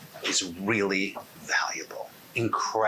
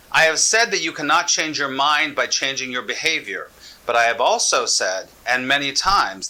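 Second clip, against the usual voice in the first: louder, running straight on with few pauses; brisk self-help reading; 5 dB louder than usual. Shown as a demonstration. Teacher demonstrating the ordinary way to read, briskly and loudly. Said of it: "I'm gonna read it to you as you would read a self-help book".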